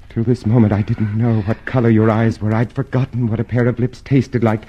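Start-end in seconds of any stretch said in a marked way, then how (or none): none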